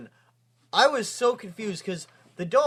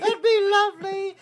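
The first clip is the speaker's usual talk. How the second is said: very high, put-on British nanny voice; hammed-up high nanny impression; 12 semitones higher, 5 dB louder than usual. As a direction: pipe it up like a wistful cartoon nanny. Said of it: "she's like".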